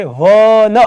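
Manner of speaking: વન drawn out in two parts, wa-na; sounded out. Shown as a demonstration. Teacher answering how it is pronounced, વન sounded out slowly. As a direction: stretch the opening wa of વન, then release na. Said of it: "ઉચ્ચાર શું થાય છે?".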